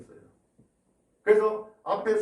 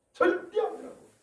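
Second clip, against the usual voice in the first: high denial. Acted. Peter's denial.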